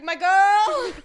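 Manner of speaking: put-on boyfriend calling out. Boyfriend watching TV calls to kitchen, loud mock holler. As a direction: holler from the couch. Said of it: "watching T V and he's like".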